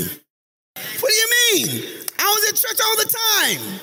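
very high, slow squealing protest; indignant churchgoer. Acted. Churchgoer protesting to Jesus at judgment, very high indignant squeal. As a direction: whine it like someone shocked to be turned away. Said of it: "I didn't know you".

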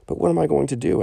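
a desperate cry for help; sad plea. Acted. Ruined merchant pleads with the friar, sad and desperate. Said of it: "asked Don Lorenzo sadly".

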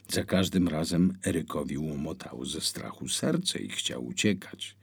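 ordinary delivery

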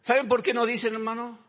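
loud, high, slowed preaching cry; preacher at full force; fiery preaching. Acted. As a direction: hammer each phrase to the congregation.

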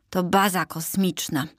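ordinary delivery

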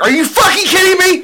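high, loud mock fury; outraged gamer yell, imitated; retold outrage. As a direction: shout it in high-pitched disbelief. Retold outrage at losing the game.

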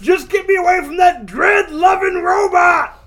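high, loud cartoon outburst; hammed-up desperate shout. Prisoner begging the guard to escape his cellmate, frantic mock shout. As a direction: holler it in comic desperation.

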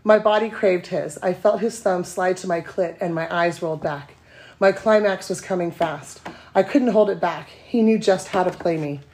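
steady read-aloud narration; story reading. Acted. Reading her steamy story to podcast cohosts, even narration. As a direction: read the romance scene out to the room.

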